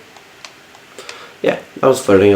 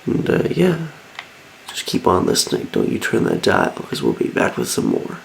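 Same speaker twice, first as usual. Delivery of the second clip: much softer, unvoiced late-night DJ voice; a sleepy, husky radio host winding down; tired radio host; 4 semitones lower, 7 dB quieter than usual. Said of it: "I love my voice when I get tired".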